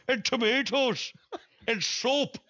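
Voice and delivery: high, scolding mock Indian accent. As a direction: play the man scolding the mouse over the rice. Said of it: "this guy's like".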